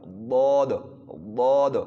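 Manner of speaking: ضاد loud and heavy, tongue side on the molars; heavy ضاد, neither d nor z; full, emphatic ضاد; side-tongue daad. Shown as a demonstration. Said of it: "یہ نہ داد ہے نہ ضاد ہے".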